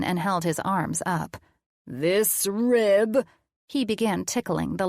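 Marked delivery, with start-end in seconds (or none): 1.9-3.2 very slow, drawn-out silly monster voice; funny voice. Acted. Colin playing mad scientist to the kids in a funny voice. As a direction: stretch it out like a cartoon mad scientist.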